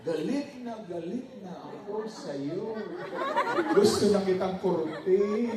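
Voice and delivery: angry words in a gentle, sing-song Ilonggo lilt; sweet Ilonggo tone. Retold or imitated. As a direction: say the threat tenderly, Ilonggo style, slowly. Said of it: "yung tono ng boses ay malambing pa rin". (malambing na tonong Ilonggo kahit galit)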